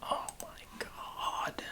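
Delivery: breathy, drawn-out whispered groan; exasperated whisper. Real, not acted. Fed up with drilling noise, whispered slow groan. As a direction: sigh it out under your breath, slowly.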